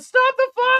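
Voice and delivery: high, loud shout to stop it; shouted plea. Real, not acted. Watching the beatdown, shouting for it to stop. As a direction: yell at them to stop the fight.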